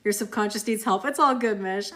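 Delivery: loud, playful teasing. Real, not acted. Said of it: "I'm just teasing you".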